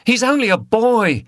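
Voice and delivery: high, loud pleading shout. A narrator's reading. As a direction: yell to defend the lad. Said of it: "shouted Liz".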